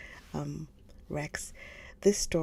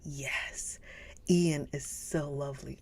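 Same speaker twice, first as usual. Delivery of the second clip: ordinary talk, nothing marked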